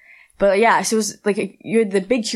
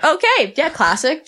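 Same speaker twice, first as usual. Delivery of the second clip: mock casual disbelief; 9 semitones higher, slower than usual. Fan watching Rey Mysterio stroll in, squeaky fake calm. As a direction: play it cool but squeak it out.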